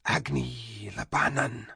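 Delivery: huge dragon hissing in a whisper. Acted. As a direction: breathe it out like an ancient dragon. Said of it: "said the vast hissing voice".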